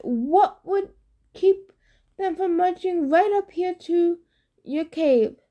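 high falsetto bride with a nervous titter; Grover as simpering bride. Acted. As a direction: overplay the coy giggling lady. Grover probing, disguised.

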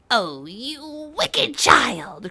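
put-on outraged old witch crying out. A narrator's reading. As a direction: drag out the shock of a furious witch. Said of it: "cried the witch".

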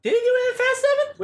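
very high-pitched, incredulous question; real surprise. Real, not acted. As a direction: shoot right up in disbelief. Surprised by a film detail.

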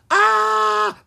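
long, very high loud scream; tumbling-downhill shriek; falling scream. A narrator's reading. Ella tumbling down the hill.

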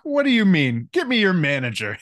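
high indignant demand for the manager; entitled Coruscant snob. Retold or imitated. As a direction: play a snooty city customer. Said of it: "That sounds like some Coruscant talk".

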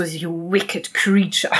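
slow, low, venomous delivery; slow mock-villain accusation in English; angry accusation. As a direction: spit it out slowly like a curse. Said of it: "guckt dann so richtig böse".

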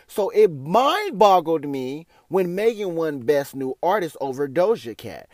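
ordinary delivery

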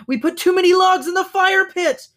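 high, loud, frantic cry; alarmed high-pitched yell; panicked shout. A narrator's reading. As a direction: call it out while fleeing in panic. Panicked moose galloping past the fire.

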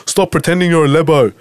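imitating a commenter who mocks his accent; mocking commenter. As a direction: mimic a snide online commenter. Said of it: "I get this comment all the time".

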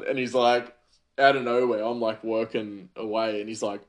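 usual speaking voice

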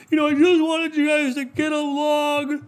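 very high, slow mock weepy wail; hammed-up tearful aunt voice; mock crying lady. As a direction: parody a relative sobbing for peace. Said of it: "one of the ladies is crying".

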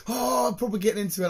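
excited. Retold or imitated. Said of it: "I'm like".

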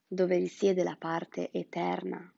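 ordinary talk

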